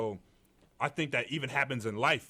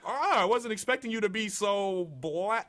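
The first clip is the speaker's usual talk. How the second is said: much higher and louder, put-on surprise; mock surprised interviewer; 10 semitones higher, 6 dB louder, slower than usual. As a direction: act out a startled interviewer. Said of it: "they're just like".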